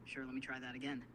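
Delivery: ordinary talk, nothing marked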